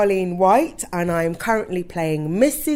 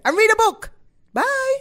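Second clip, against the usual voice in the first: high cheery sign-off; 6 dB louder than usual. Acted.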